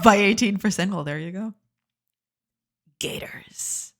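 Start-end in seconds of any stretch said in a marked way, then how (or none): 3.0-3.9 whisper. Real, not acted. Whispered joke aside.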